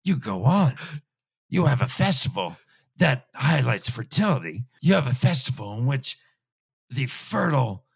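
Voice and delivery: normal talking voice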